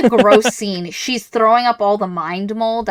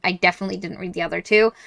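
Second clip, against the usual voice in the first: ordinary delivery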